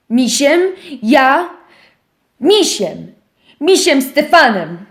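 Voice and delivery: głośniej, z rosnącym zdumieniem; wołaj coraz radośniej; wołanie. Acted. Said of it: "zawołał szczur". (miś woła zdumiony, głośniej)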